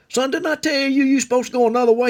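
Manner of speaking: high-pitched, worked-up scolding; fussing old man. Retold or imitated. Old man scolding the boy who chased him, high fussing. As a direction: fuss in a strained high voice. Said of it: "he fussed at me".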